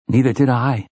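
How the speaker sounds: ordinary delivery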